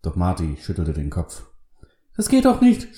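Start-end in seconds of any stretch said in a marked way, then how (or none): 2.2-3.0 desperate, very high-pitched yell; loud, shrieked protest; screaming. A narrator's reading. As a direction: cry out in frantic protest. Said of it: "schrie Lester".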